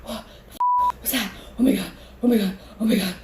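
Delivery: acted gasping, rising panic; hyperventilating panic. Freaking out downstairs to friends, hyperventilating panic. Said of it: "I'm, like, hyperventilating. I cannot f***ing breathe".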